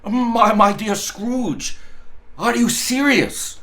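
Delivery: can hardly believe his ears; breathless, incredulous old gentleman; amazed disbelief. A narrator's reading. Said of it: "as if his breath were gone".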